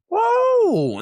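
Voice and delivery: loud high owl whoop; owl call whoop. Retold or imitated. Retelling calling at an owl before daylight, loud high whoop. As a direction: let out one sharp high owl call. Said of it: "And I went".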